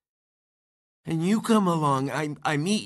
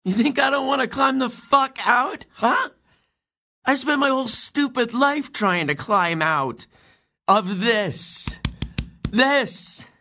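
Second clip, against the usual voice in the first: higher, louder pained shouting; angry outburst; 6 semitones higher, 3 dB louder than usual. Acted. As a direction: throw it back at her, hurting. Amy's outburst in argument.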